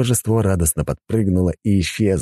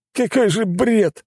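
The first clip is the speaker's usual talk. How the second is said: exasperated disbelief; much slower than usual. Acted. Man clutches his head in despair.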